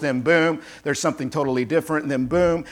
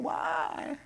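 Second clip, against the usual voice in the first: put-on childlike why; 11 dB quieter than usual. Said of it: "they're almost like children".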